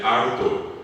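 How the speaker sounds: "Harto" for alto, R in place of L; imitated conscript's "Harto", R for L. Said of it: "no podían decir alto".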